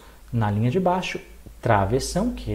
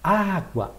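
"Água" with a higher, held first syllable; stress on first syllable; 4 semitones higher than usual. Shown as a demonstration. Teacher showing students the strong syllable, stressed "Água". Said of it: "tem uma sílaba que ela é mais forte".

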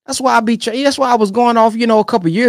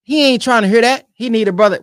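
usual speaking voice

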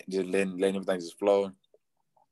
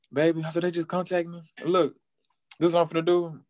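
excited news; 10 semitones higher than usual. Retold or imitated. Retold good news to girlfriend.

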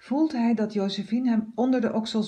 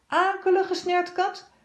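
scolding cleaner; 10 semitones higher, 3 dB louder than usual. A narrator's reading. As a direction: berate the thief. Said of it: "scheldt ze". (een hoog, luid scheldende werkster)

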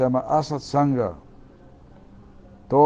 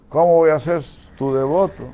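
higher, louder declaiming of the sacred verse; preaching at full force; 3 semitones higher, 5 dB louder, slower than usual. Acted. Preacher reciting Krishna's words to listeners, loud and high. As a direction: thunder the verse out to the congregation.

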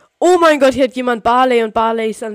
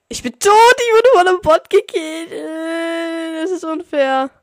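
loud, high frustrated outburst; whiny yelling complaint; outraged yell; 8 semitones higher, 9 dB louder, slower than usual. Real, not acted. Killed by a bot, outraged.

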